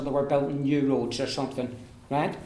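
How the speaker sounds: ordinary talk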